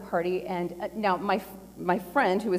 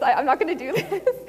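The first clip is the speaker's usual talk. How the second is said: laughing through a reluctant refusal; 8 semitones higher, 6 dB louder, faster than usual. Retold or imitated. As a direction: giggle it out, a bit rushed. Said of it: "there was probably a dozen times where I'm like".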